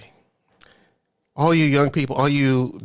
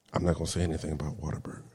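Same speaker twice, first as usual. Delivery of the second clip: a fast, hushed aside; quiet aside; 8 semitones lower, 13 dB quieter, much faster than usual. Real, not acted. Vlogger on fast food workers, hushing her own jab at Whataburger, quiet and fast. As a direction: drop it low and fast, half under the breath. Aside, not saying it openly.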